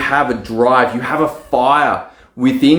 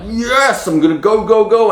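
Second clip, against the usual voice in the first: eager go go go; 9 semitones higher than usual. Retold or imitated. Eager entrepreneur cheering himself on, high and pumped. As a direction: psych yourself up like you can't wait to start. Mock eager self, starting the funnel.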